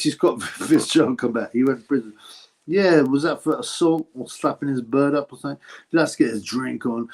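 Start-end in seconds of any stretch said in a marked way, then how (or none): none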